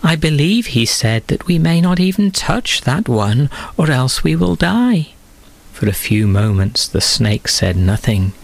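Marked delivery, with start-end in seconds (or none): 0.0-5.1 higher, earnest voice of Eve; lightly voiced Eve; Eve exaggerating. A narrator's reading. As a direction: stretch the rule a little, innocently. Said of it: "she added".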